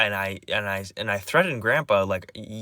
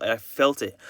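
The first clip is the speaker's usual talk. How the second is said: ordinary delivery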